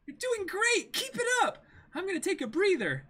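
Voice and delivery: put-on cheery girl. Reading Nami slacking off.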